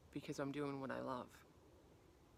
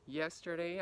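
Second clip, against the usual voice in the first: ordinary delivery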